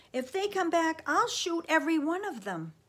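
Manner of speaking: loud, high-pitched threat; angry cry. A narrator's reading. Sam vowing revenge on the soldiers, angry. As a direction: hurl the threat out furiously. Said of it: "he cried".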